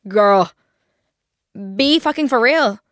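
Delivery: sassy retort. Retold or imitated. Her retort to ex.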